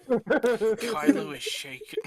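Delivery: line broken up by laughter; laughing. Real, not acted. GM laughing through the narration at the table. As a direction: try to read it but crack up. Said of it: "are we gonna redo the line".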